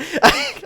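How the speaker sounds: giggling fit after a yell; high burst of laughter. Real, not acted. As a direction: break into laughter. Laughing after his loud call.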